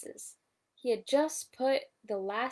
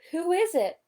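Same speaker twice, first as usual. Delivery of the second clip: higher, louder startled question; 8 semitones higher, 7 dB louder than usual. A narrator's reading. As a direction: ask in surprise. Owl startled awake.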